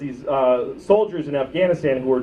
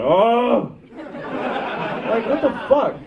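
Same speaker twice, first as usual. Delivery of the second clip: shocked Iowan; 8 semitones higher, much slower than usual. Retold or imitated. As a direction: gasp like a scandalized farmer. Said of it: "a guy in Iowa going".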